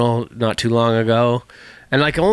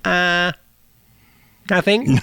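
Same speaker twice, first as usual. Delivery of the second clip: higher, hesitant dodge; evasive; 6 semitones higher, slower than usual. Retold or imitated. Son-in-law caught browsing the Bible, higher evasive reply. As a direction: caught out, stalling before answering. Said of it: "And just be like".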